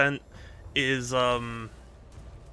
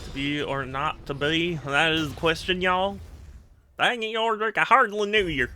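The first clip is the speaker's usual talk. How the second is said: put-on Southern accent; 4 semitones higher than usual. Streamer joking about Shakespeare in old accent, hammed-up Southern accent. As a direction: recite Shakespeare as a playful Southerner. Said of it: "are probably most accurate with that accent".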